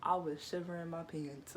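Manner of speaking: normal talking voice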